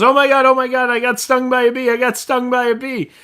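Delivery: high-pitched frantic joke character; panicked woman. Acted. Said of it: "a woman comes running in".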